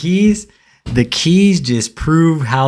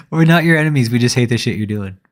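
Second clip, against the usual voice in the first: ordinary talk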